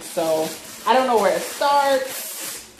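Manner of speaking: usual speaking voice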